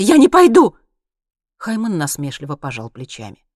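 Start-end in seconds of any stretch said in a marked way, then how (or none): 0.0-0.8 high, loud refusal; exclaiming. A narrator's reading. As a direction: cry out that you refuse. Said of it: "воскликнула Монти". (громко, высоким голосом отказываясь)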